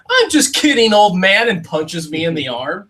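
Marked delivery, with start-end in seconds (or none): 0.1-1.5 high, loud playful teasing; teasing kid. Retold or imitated. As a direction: tease like a cheeky kid. Kid laughs and teases.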